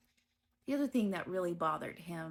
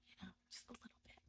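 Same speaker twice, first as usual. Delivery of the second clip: breathy little whispered aside; 4 semitones higher, 19 dB quieter, much faster than usual. Real, not acted. Telling the interviewer about his bullying, whispered aside.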